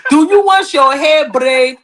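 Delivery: lightly put-on African accent, hair braider. Host teasing the caller in an African accent. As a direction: ask it like a braiding-shop lady. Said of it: "That African accent".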